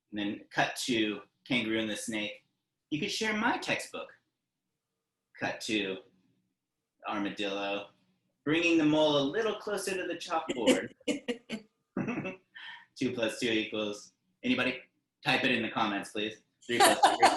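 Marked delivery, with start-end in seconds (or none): 2.9-4.1 higher, friendly snake voice; kind snake. Acted. Snake offers to share. 16.7-17.3 much higher, louder burst; silly counting. Acted. Teacher laughingly botches the math.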